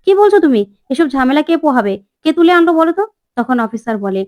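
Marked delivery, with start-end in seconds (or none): 0.1-3.0 higher and louder, exasperated demand; startled boss snapping down the phone; annoyed outburst. Retold or imitated. Meghnath on the phone to the officer, exasperated outburst.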